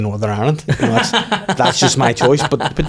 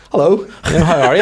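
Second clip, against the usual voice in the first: higher, louder chirpy hello; a bright overfriendly hello; friendly greeting; 6 semitones higher, 4 dB louder, faster than usual. Retold or imitated. Greeting the banker in the jacuzzi, chirpy hello.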